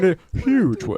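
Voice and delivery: high loud radio hype. Pretend. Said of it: "we're doing our radio voices".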